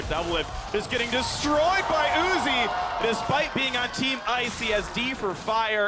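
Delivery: excited play-by-play. Real, not acted. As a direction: stretch the big moment as you call it.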